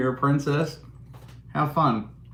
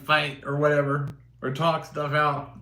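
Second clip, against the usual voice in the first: ordinary talk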